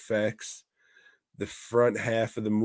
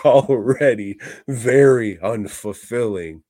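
laughing; 9 dB louder than usual. Real, not acted. Laughing at his own joke.

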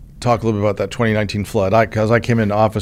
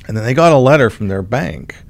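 ordinary talk, nothing marked

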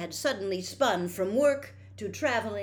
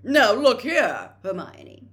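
slow, much louder stern lecture; pompous rebuke; 6 semitones higher, 15 dB louder, slower than usual. A narrator's reading. Percy rebuking Hermione, slow and loud. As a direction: put her firmly in her place.

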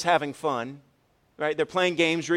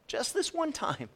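much higher, incredulous repeat of "Just this one time"; mocking echo; 11 semitones higher, 3 dB quieter than usual. Preacher mocking the congregation's favorite excuse, high and incredulous. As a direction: throw the excuse back, high and scoffing.

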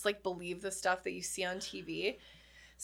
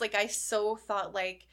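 ordinary talk

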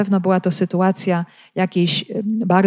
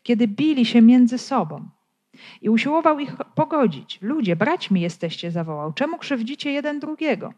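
measured scripture read with the quotes lifted higher; 4 semitones higher, 3 dB quieter than usual. Acted. Preacher reads Acts aloud to the congregation, measured scripture reading.

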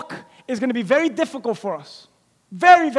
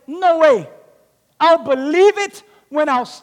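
louder and higher, scoffing; loud scoffing disbelief; 8 semitones higher, 7 dB louder than usual. Retold or imitated. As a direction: brush them off with a loud no way. Thomas retorting to disciples.

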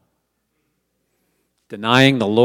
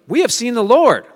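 high, loud excited report; a bright, raised shout of joy; joyful announcement; 10 semitones higher, 5 dB louder than usual. Retold or imitated. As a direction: burst out with the good news.

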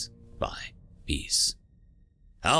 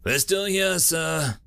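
mildly strained, higher-pitched reply; understated pained grunt of an injured doctor; 6 semitones higher, slower than usual. A narrator's reading. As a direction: answer with a touch of pain. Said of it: "he grunted, sounding pained".

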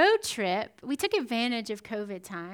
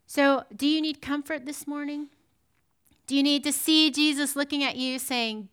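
normal talking voice

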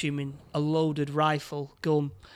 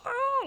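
sudden high-pitched mock scream; imitated scream. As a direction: squeal it out as a retold scream. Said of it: "the woman screams like".

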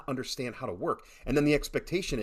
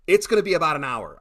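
louder, higher yell across the room; yelled over counter; 5 semitones higher, 9 dB louder than usual. Retold or imitated. Lone worker yelling the wait time to customers, loud. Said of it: "she yells over the counter".

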